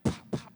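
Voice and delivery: two loud, sharp pops; explosive vocal punch noises; double punch sound. Retold or imitated. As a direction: imitate two punches landing. Imitates two knockout punches.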